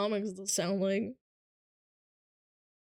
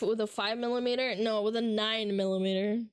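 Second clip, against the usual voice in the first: ordinary talk, nothing marked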